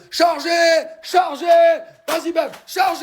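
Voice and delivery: high-pitched shouted order to charge; rally the troops in mock urgency; play battle cry. Man urging Bam on to wake someone, playful shouted command.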